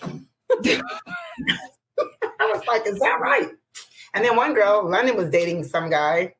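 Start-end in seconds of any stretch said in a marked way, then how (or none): 0.0-0.8 sharp high shriek of laughter; shocked laugh. Real, not acted. 2.8-3.5 high, sarcastic disbelief; incredulous. Retold or imitated. Incredulous retort.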